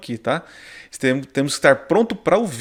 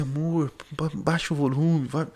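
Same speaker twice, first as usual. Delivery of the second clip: hushed aside to wife; 7 dB quieter than usual. Retold or imitated. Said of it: "eu falo assim".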